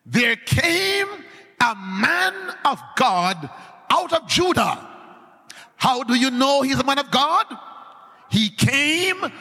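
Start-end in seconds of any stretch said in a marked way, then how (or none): 0.1-4.8 scripture reading. Shown as a demonstration. Rereads the text. 8.3-9.3 high ringing preacher shout, dragged out slow; preaching. Acted. Preacher proclaiming the word to the congregation.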